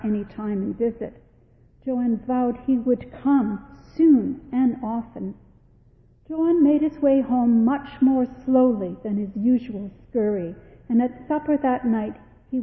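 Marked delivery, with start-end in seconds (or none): none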